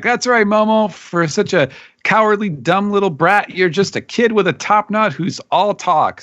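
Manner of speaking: high, hammed-up cartoon yell; mock shouting back. Luffy yelling back at Momonosuke, high mock shout. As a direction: tease him in a squeaky, bratty shout. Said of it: "shouting back".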